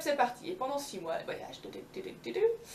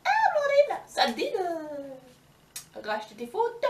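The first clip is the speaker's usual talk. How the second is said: much higher, slow, drawn-out creature voice; tortoise tempting Blondine, slow and high; old tortoise voice; 8 semitones higher, 7 dB louder, much slower than usual. Acted. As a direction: stretch every word like a sleepy old tortoise. Giant tortoise speaks to Blondine.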